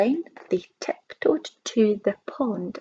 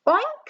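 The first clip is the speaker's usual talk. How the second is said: far higher squealing oink; 14 semitones higher than usual. Acted. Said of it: "said the hog".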